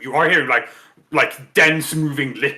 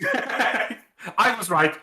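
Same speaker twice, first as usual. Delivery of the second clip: laughing; 6 semitones higher, much slower than usual. Real, not acted. Gloating after winning argument.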